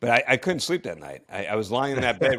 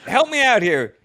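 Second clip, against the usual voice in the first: higher and louder, urgent demand to the flight director; frantic plea; 11 semitones higher, 6 dB louder than usual. Retold or imitated. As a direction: beg Houston for help, all worked up. Frantic call to Mission Control.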